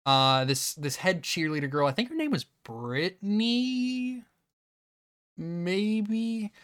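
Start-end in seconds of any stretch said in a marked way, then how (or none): 2.7-6.5 high, drawn-out uncertain guess; tentative and slow, pitched up; unsure recall. Real, not acted. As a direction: stretch it out high, as if searching memory. Unsure recalling a character's name.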